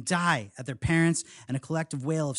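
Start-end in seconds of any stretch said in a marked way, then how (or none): none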